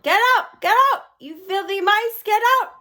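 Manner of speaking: high, loud shrieking shout; teachers shouting. Acted. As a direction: shoo them off with a shriek. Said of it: "stand upon the desk and shout".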